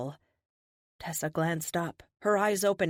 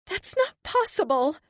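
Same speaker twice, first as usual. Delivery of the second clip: very high, stunned disbelief; high and close to tears; high, tearful disbelief; surprisingly high voice; 13 semitones higher, 5 dB louder than usual. Acted. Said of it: "Her voice is surprisingly high".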